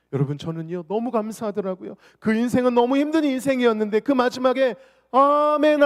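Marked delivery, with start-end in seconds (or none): 5.1-5.8 joyful amen. Retold or imitated. Retelling final amen joyfully.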